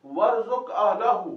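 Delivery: slow, emphatic Quranic reading; slow recitation. Shown as a demonstration. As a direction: recite the verse slowly for the class. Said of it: "आगे देखिए".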